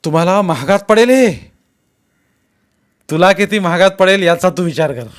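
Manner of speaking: shouted warning. A narrator's reading. Jaydeep and the masked man trade threats, shouting. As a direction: shout the threat across the crowd. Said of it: "तरी तो ओरडला".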